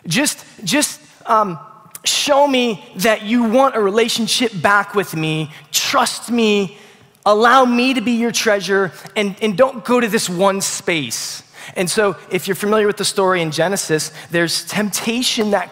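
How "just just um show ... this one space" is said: loving plea. Retold or imitated. God lovingly pleading with Adam and Eve.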